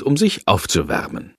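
plain reading voice

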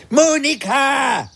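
drawn-out call across the garden. Acted. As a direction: call her name, long and slow. Calling for friend in garden.

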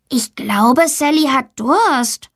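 put-on child speaking up in class; schoolboy Max. A narrator's reading. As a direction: draw it out like a child telling the teacher. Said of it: "sagt Max".

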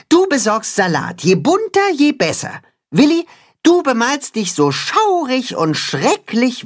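high, earnest rallying voice; high earnest cat-hen rallying the farm animals. Acted. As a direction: unite them, high and determined. Cat-hen giving tasks.

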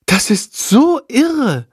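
drawn-out awe at the clever bat; amazed. Acted. Girl marvelling at the counting bat, slow and amazed. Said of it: "staunt Lilly".

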